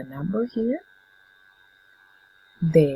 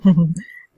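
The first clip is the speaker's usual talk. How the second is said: laugh; 10 dB louder than usual. Real, not acted. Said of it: "which I do love to do".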